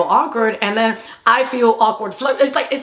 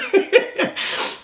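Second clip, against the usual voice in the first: high breathless giggle at her own joke; airy giggle; 11 semitones higher than usual. Real, not acted. Joking grandmother beat him.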